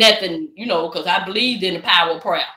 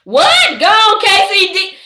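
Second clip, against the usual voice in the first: a much higher, louder cheer for a viewer; excited cheer; 10 semitones higher, 5 dB louder, much slower than usual. Real, not acted. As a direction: shout her on, thrilled.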